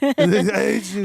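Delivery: slow, high, loud shout through a laugh; laughing mock shout. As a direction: drag out the yell, cracking up. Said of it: "And you're like".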